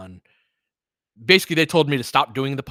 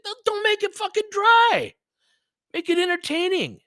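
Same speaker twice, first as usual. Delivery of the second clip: impassioned retort; 3 dB louder than usual. Acted. Retorting to critics.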